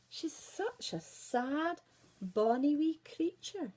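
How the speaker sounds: slow, high, tender sigh; tender Big Head. Acted. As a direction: pity the poor lass. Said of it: "said Big Head".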